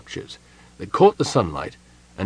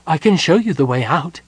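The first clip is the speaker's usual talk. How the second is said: higher, eager gnome voice; 6 semitones higher, 4 dB louder than usual. A narrator's reading. Gnome offering the kender the way out, eager. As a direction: offer it keenly, pitch lifted. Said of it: "the gnome added eagerly".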